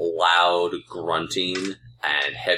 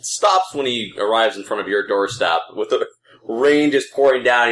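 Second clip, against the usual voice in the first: ordinary delivery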